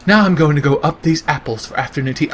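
mock British gentleman narrator; VaatiVidya as British. British lore narrator off to afternoon tea, mock British accent. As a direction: exaggerate the British accent for comedy. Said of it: "but my version of him is".

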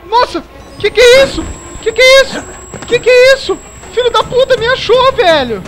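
high, loud shrieking in terror; terrified screaming. Real, not acted. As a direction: shriek like you're being caught. Monster found him in game.

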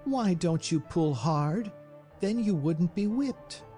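high, pitying plea; sympathetic cry. A narrator's reading. Mercedes pleading with the dogs, sympathetic high cry. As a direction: coax with pity in a high voice. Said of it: "she cried sympathetically".

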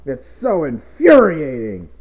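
loud, fuming stress on infuriating; exasperated, loud and falling in pitch; infuriated. Acted. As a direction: fume it out, leaning hard on infuriating. Fuming after the outburst.

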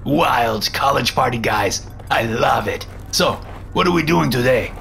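put-on chummy foreign-accented banter, pitch swinging up; Dragon, jovial landlord. A narrator's reading. Landlord's voice in story.

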